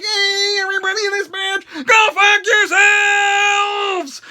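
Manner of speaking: high, drawn-out mock yell; mock shout. Kevin Owens telling everyone off, high drawn-out mock yell. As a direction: yell it, stretching out the curse. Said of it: "he's like".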